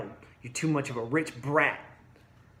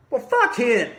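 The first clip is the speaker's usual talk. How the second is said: louder and higher, spit it out; angry outburst; 8 semitones higher, 6 dB louder than usual. Real, not acted. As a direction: dismiss him in real anger. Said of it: "I'm really pissed off about it".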